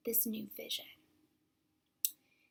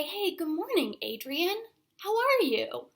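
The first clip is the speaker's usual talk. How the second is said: higher, lilting hello to a friend; friendly greeting; 6 semitones higher than usual. Retold or imitated. Couple greets their colleague at staff worship, friendly greeting. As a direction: greet a colleague with an upbeat lift.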